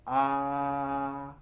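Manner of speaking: "आ" held level and even; first tone demo. Shown as a demonstration. Teacher modelling the level first tone for students. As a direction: keep "आ" flat and steady, Mandarin first tone. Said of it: "first tone".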